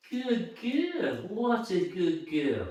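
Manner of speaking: tender sing-song praise; soft dog praise. Real, not acted.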